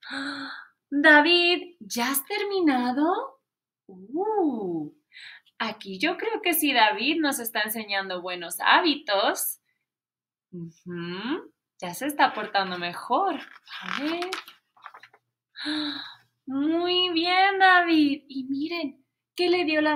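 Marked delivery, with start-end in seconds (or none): 0.9-3.4 teacher asking. Acted. Teacher checking on David. 16.5-18.2 teacher praise. Acted.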